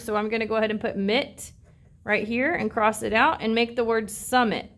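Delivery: ordinary delivery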